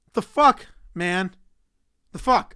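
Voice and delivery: a real loud "the fuck?" of disbelief; incredulous outburst. Real, not acted. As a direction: blurt it out, outraged and baffled. Sudden outburst at the topic.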